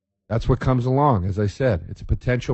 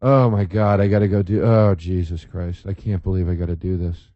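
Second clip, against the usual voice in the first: grudging, put-upon muttering; lightly voiced annoyed guest sighing at the chore; eye-rolling groan; 5 semitones lower than usual. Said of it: "rolling their eyes going".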